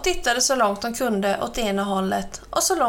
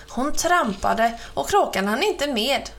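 ordinary delivery, nothing marked

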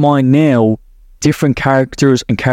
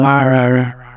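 a mock dog growl, slightly lower; pit bull growl; 3 semitones lower than usual. Explaining fears, mocking a threatening pit bull growl. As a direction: growl out the rahs like an angry pit bull, half-joking. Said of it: "They don't sound like a pit bull".

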